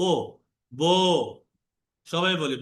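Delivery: each syllable ends in a rounded o; বো said as bo, lips made round; o vowel drill. Shown as a demonstration. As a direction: say বো, জো, তো with a round o, not oo. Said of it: "এবার ও এর মতো করো".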